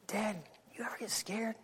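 put-on whispered child's question; boy whispering to his father in a closet. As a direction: breathe it softly to dad. Small boy asking dad quietly.

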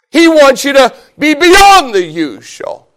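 high, slow emphatic proclamation; forceful preaching. Real, not acted. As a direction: ring it out over the congregation.

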